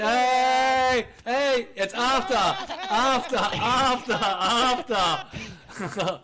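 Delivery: high, loud, laughing call; excited shouting. Acted. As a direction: yell it out, cracking up. Excited mock shout at players.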